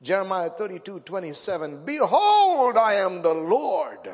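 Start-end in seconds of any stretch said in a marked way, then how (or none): 1.9-4.1 booming, slow, much louder proclamation; thundering scripture. Acted. Preacher booming Jeremiah to the congregation, thundering. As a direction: proclaim God's words at full force.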